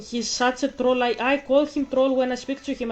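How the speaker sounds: usual speaking voice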